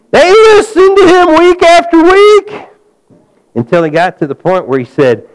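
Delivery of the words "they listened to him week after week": high, loud preaching build; full-force preaching. Acted. Pastor rousing the congregation against Satan's opposition, full-force preaching.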